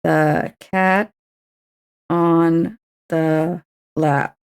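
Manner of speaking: very slow, spaced words; slow syllable count; slowly counting syllables. Shown as a demonstration. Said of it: "write this down".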